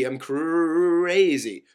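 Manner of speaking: CRAZY hugely drawn out, loud and high; mocking exasperation. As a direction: stretch out "crazy" in loud mockery. Mockingly repeats the accusation, stretched.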